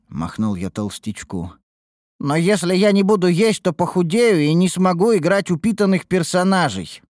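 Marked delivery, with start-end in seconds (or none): 2.2-7.0 put-on raised, pleading boy voice; a hungry kid begging for food; whiny boy. A narrator's reading.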